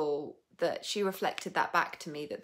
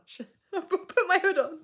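higher and laughing; giggly high question; 9 dB louder than usual. Real, not acted. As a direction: ask it through giggles. Laughing at her hair.

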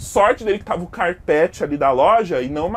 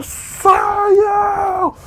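much higher, loud commanding shout; 8 dB louder than usual. Acted. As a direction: blast them out with a yell. Battle cry clearing the shop. (grito alto mandando todos saírem)

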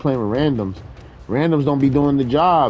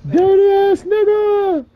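very high, drawn-out mock curse; mock-outraged wail; much slower than usual. Gamer curses teammate who left him, mock-outraged high wail. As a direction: stretch the insult into a high squeal.

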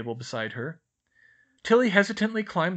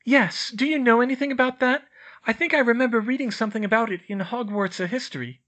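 put-on higher, louder girl's voice; an eager schoolgirl urging friends on; Hermione, brisk; 11 semitones higher, 8 dB louder than usual. A narrator's reading. Hermione urging the boys toward dinner, brisk and higher.

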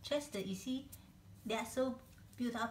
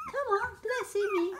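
squeaky quick pet talk, pitched way up; higher, faster coaxing; 11 semitones higher, 7 dB louder, much faster than usual. Real, not acted. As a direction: chirp it quickly at the puppy. Coaxing puppy, repeated take.

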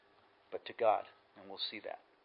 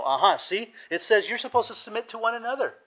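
high, quick imitation of triumphant objectors; loud, high-pitched mock "aha"; mock gotcha; 9 semitones higher, 6 dB louder than usual. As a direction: mimic someone pouncing on a proof text. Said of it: "So they immediately go".

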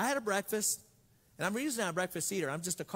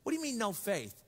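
usual speaking voice

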